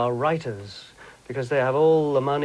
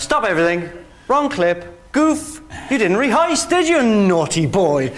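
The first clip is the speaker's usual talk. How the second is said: mock scolding; 7 semitones higher, 5 dB louder than usual. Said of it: "I went".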